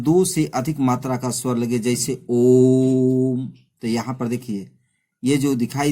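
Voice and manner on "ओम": ओम stretched very long, louder, like a chant; prolonged ओम. Shown as a demonstration. Teacher demonstrating a pluta vowel to students, long drawn-out ओम. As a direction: hold ओम for several beats, ओ drawn out well past two matras. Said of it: "लंबे समय तक लगातार उच्चारित किए जाएं".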